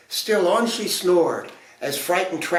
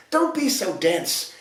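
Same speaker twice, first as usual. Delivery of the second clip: higher, scolding fairy voice; scowling fairies; 9 semitones higher than usual. A narrator's reading. Fairies to the dense prince, high scowling scold. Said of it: "The fairies scowled".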